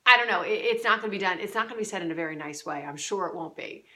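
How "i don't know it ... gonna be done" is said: exasperated. Retold or imitated.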